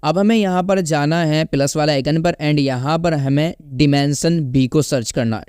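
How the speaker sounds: normal talking voice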